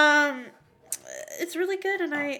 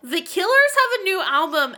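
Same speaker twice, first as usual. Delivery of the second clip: proclaiming; 6 semitones higher, 6 dB louder than usual. Pretend. Continues the proclamation.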